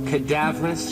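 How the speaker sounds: plain reading voice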